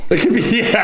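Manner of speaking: laughing. Real, not acted. Laughing at the vehicle joke.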